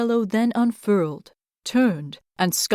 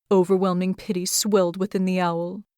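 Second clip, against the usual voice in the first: usual speaking voice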